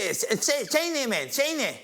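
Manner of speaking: very high-pitched caller impression; high, halting boxer on the line; Mike Tyson. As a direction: imitate the champ asking for someone on the phone. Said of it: "he rings my mate's phone".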